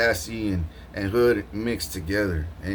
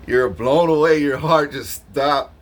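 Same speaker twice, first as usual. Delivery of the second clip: excited telling; 6 semitones higher, 7 dB louder, slower than usual. Retold or imitated. Describing listener's shock.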